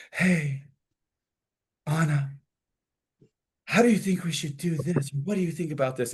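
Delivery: put-on eager young wife. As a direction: play the newlywed asking an older woman for advice. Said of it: "will be asked a lot by younger ladies who are just married".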